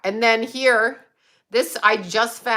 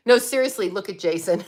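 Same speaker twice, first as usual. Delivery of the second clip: normal talking voice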